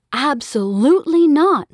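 a mother refusing outright, loud and firm; firm mom refusal. Acted. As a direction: shut it down like a stern mother. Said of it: "Mom said".

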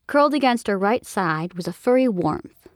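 usual speaking voice

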